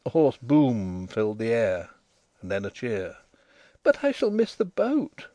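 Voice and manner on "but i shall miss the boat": put-on much higher girlish voice, mildly protesting; dazed protest. A narrator's reading. Dazed girl protesting to Denry at the cab, high-voiced. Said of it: "the dazed girl protested".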